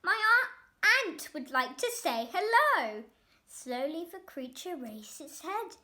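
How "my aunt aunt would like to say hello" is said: loud, high bossy call; a boy summoning his pet; yelling. A narrator's reading. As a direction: rude shout. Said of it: "Roy yelled".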